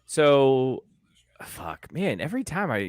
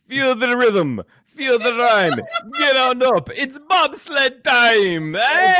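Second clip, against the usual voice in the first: high, hammed-up Jamaican chant; gleeful bad Jamaican accent, pitched up; mock Jamaican accent; 6 semitones higher, slower than usual. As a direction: build to a drawn-out Jamaican cheer. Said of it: "I'd be like".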